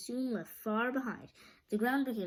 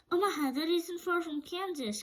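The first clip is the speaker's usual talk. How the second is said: high, bright surprised voice; surprised cry; 9 semitones higher than usual. A narrator's reading. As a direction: blurt it out in surprise. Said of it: "cried Dorothy".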